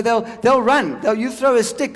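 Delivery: ordinary talk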